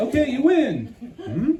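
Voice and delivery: very high, loud frightened squeal; scared armadillo. Acted. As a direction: plead high and loud to be left alone. Scared armadillo rolled into a ball.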